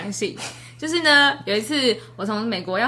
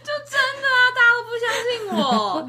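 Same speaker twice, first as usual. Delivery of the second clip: high sing-song whine; mock whining; 13 semitones higher than usual. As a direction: whine like a wronged child. Whining nobody believes her. (假装委屈地唱着抱怨)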